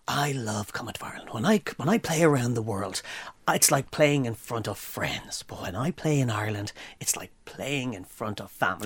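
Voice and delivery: soft, whispery mock-sincere country star. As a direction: breathe it like a charming star. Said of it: "he came in, he said".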